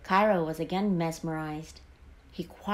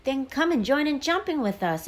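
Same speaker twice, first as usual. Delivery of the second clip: high-pitched, bouncy invite; keen storybook frog welcoming a friend; eager invitation; 7 semitones higher, 4 dB louder than usual. A narrator's reading. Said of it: "invited the yellow frog eagerly".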